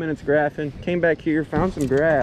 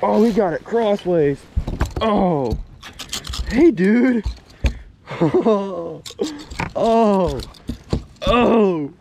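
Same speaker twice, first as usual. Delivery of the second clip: excited; 6 semitones higher, much slower than usual. Real, not acted. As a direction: whoop it up, thrilled. Big fish just landed.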